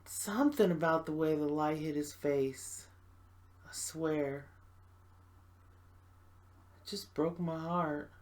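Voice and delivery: low, soft, wistful confession; quiet heartbreak. Acted. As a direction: let the voice sink as the memory hurts. Recalls the man who broke heart.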